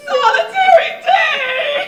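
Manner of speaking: slow, high, loud wail; bursting into tears. Acted. Guest lamenting her marriage to George, wailing. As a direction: let the words rise into a howl of grief. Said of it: "I haven't cried since I was fourteen".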